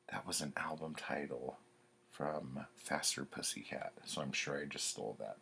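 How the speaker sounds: hushed aside; whispering. Real, not acted. Whispered aside about album title.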